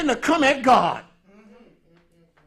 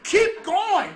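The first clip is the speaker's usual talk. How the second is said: self urging; 11 semitones higher than usual. Retold or imitated. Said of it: "But I said".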